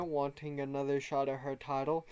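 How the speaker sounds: usual speaking voice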